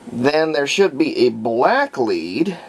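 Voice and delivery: usual speaking voice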